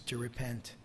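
sudden hushed whisper on the last words; breathy dramatic whisper. Real, not acted. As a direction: drop to a bare whisper for weight. Preacher drops voice on repent.

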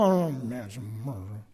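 low mumble of a crowd; mob muttering. Shown as a demonstration. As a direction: grumble like one of a restless mob. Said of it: "discontented muttering of a mob".

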